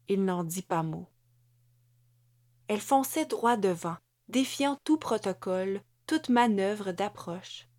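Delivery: ordinary delivery, nothing marked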